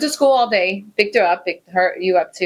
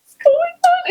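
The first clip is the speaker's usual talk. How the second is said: very high, louder bewildered voice; baffled doctor; 4 dB louder, slower than usual. Retold or imitated. Doctor confused by the cheering boy, very high and bewildered. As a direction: sound utterly thrown by the scene. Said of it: "he's just like".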